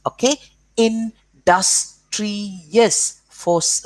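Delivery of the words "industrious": industrious very slowly as "in... dus... tri... ous", raised pitch; split into syllables. Shown as a demonstration. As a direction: give industrious four separate beats. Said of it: "four syllables".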